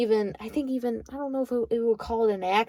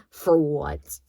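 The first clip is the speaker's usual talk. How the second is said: her signature for what; 4 semitones lower than usual. Retold or imitated. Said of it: "the way I say it".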